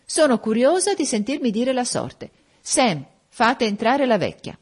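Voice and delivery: put-on high-pitched, wilful young lady; imperious. A narrator's reading. Bianca orders the servant to fetch the woman, imperious.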